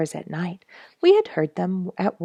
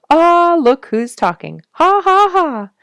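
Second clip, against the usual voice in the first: high, loud mocking chatter; chattering squirrel; 12 semitones higher, 12 dB louder than usual. A narrator's reading. Squirrel mocking the speaker, high and loud. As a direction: tease like a cheeky squirrel. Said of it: "a squirrel chattered".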